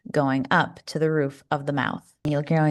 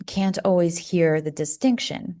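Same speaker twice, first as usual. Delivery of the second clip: ordinary talk, nothing marked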